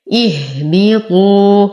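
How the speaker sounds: slow اهبطوا with initial i and long final ū; slow tajweed recitation. Shown as a demonstration. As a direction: begin اهبطوا on a clear i, chanted slowly. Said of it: "ابتدأنا بها مكسورة". (ترتيل اهبطوا ببطء مع كسر همزة البدء)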